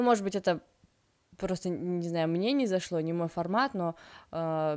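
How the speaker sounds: normal talking voice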